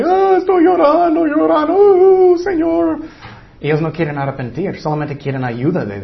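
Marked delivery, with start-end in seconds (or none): 0.0-3.0 mock weeping penitent. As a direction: put on a loud, exaggerated crying plea at the altar. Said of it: "Muchas personas pueden ir enfrente".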